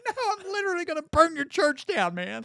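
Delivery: high-pitched, hammed-up protest; mock panic. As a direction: plead in exaggerated alarm, voice jumping up. Retold panicked argument over flames.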